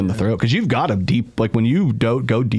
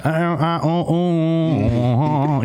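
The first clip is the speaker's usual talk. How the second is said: high wordless mumbling, stretched; hammed-up mumbling oh sounds; imitating Ted; 6 semitones higher, much slower than usual. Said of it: "Ted when he's talking about songs".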